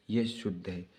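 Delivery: ordinary talk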